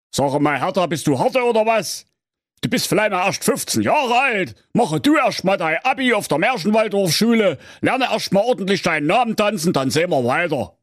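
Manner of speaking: put-on father lecturing his teenage daughter; stern father. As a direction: scold her like a know-it-all father.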